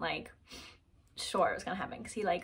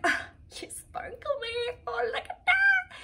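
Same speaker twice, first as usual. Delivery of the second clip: very high, hammed-up adoring squeal; gushing delight; 6 dB louder than usual. Admiring the ring on her finger, gushing squeal. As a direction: coo at it in a tiny high voice.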